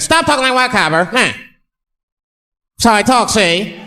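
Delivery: high, old-time bad guy voice; defiant mock gangster back-talk; crazy gangster talk. Driver arguing with police, high forties gangster voice. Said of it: "I'll talk crazy".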